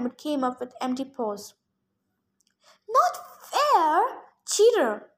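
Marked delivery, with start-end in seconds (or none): 2.9-5.1 high-pitched wail of protest. A narrator's reading. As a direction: wail like a cheated sore loser. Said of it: "the Sphinx wailed".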